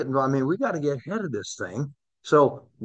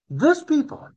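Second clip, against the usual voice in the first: "This" pitched much higher and punched; stress on this; 13 semitones higher than usual. Shown as a demonstration. Teacher contrasting this people with those, stressing "This". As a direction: lean hard on "This". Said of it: "He didn't say those people".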